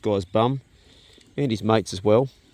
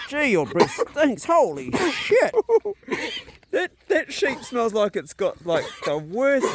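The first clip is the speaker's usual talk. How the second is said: high, drawn-out words through real laughter; laughing; 11 semitones higher, slower than usual. Real, not acted. Cracking up to viewers over a sheep's stinking breath, laughing. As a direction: barely hold back the laugh, pitched way up. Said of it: "I shouldn't laugh".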